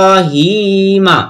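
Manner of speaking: "ইব্রাহিমা" slow, with "রা" and "হি" stretched long; drawn-out vowels. Shown as a demonstration. Teacher showing where to draw it out, slow stretched "ইব্রাহিমা". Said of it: "এখানে র টেনে পড়বো".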